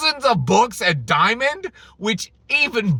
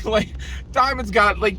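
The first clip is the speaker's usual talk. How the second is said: ordinary talk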